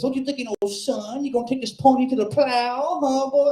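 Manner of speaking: put-on high, twangy old barfly voice; aunt needling the comic, high and mocking. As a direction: lean in like a leering old aunt. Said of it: "the aunt turned to me and says".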